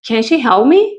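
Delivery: usual speaking voice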